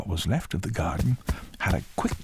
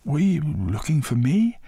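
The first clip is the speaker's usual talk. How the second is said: put-on high, louder boy's voice; Cocky; 9 semitones higher, 5 dB louder than usual. A narrator's reading. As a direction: ask eagerly in a high boy's voice. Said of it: "Cocky said".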